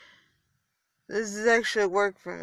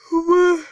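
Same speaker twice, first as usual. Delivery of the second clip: loud open yawn that rises high; real yawn; 8 semitones higher, 8 dB louder than usual. Real, not acted. Tired podcaster yawning mid-episode, loud yawn. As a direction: let a big tired yawn out. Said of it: "I'm yawning".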